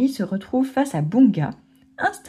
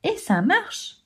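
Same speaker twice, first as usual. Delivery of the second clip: high, delighted exclamation; excited cry; 11 semitones higher than usual. Acted. Bunga seeing the water blocked, a high excited cry. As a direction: cry it out in triumph, pitched up. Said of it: "s'écrie Bunga".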